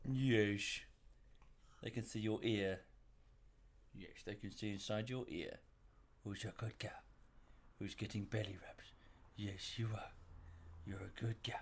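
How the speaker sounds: very soft, low cooing to a dog; doting pet talk. Real, not acted. Cooing softly to her dog.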